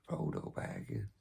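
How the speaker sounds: breathy whisper of a name. Acted. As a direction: hush it like a secret. Lord of the Rings bit.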